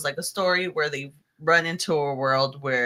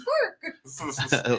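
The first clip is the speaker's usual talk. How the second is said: mock dog bark. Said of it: "the dog says".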